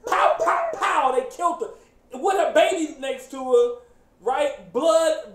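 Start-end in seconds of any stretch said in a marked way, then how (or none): 0.1-1.1 high, loud pops; gunshot imitation. Retelling how they killed her, imitated gunshots. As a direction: shout each pow like a shot.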